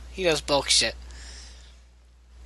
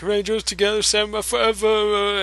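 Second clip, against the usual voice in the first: higher chant-like hero call; hero rally call; 5 semitones higher than usual. Retold or imitated. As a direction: call it like a Ranger team. Quoting the show's rally call.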